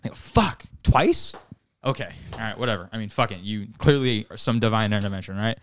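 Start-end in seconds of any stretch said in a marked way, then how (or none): 0.1-1.3 high, startled exclamation on twice; shocked disbelief. Acted. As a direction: act floored that he lived again. Executioners shocked man survived again.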